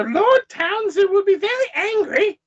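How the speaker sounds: very high squeaky lady; high-pitched put-on Englishwoman; Martha. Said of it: "it should be Martha".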